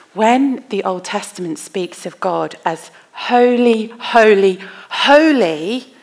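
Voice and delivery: ringing triple "holy"; threefold emphasis. Shown as a demonstration. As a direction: build "holy, holy, holy" as a proclamation. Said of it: "to say something three times".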